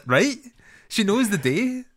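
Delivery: high-pitched mock actor delivery; film character parody. Imitating the guy at the film's beginning, overdramatic. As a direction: overplay it far up in pitch. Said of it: "the guy at the beginning".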